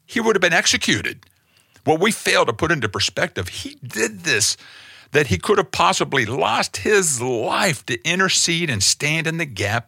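high, urgent preacher's cry; pleading sermon peak; rising impassioned preaching. Real, not acted. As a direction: let the pitch climb with urgency. Preaching at full force.

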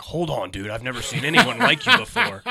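loud, laughing fake awe; mock amazed. Mocking an impersonator's hoped-for praise, loud. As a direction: fake being blown away. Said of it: "like he thought I was gonna be like".

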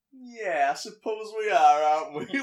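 high, loud, knowing and suspicious. Retold or imitated. Vermin eyeing the gang girl, loud and high suspicion. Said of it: "gives her this suspicious face and he goes".

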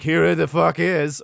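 a lightly hammed-up savage holding up his prize; Game of Thrones brute. Game of Thrones brute presenting the tokens, loud and slow. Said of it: "I can go full Game of Thrones".

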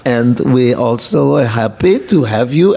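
mock Russian host's welcome; Russian accent. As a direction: imitate Russian organizers greeting him politely. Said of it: "And so they said".

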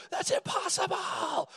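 very high, drawn-out mock shriek; hammed-up squeal of amazement, slow and stretched, no real volume; mock hysterical disbelief. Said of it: "It's their unbelief that says".

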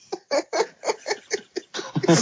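high burst of laughter. Real, not acted. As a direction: cackle at the joke. Laughs at own math joke.

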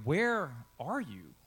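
WHERE drawn out and leaned on, then a beat; stress on WHERE. Shown as a demonstration. Preacher showing how emphasis changes meaning, stress on "Where". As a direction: hit the word "Where", stretching it before "are you". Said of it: "you can emphasize different, the different words".

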